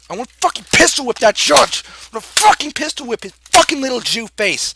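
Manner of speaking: high, loud furious yell; raging threat. Acted. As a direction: shout it in an enraged outburst. Rant peaks in threats at judge.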